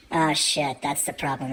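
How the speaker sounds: ordinary talk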